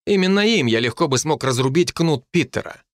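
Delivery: ordinary delivery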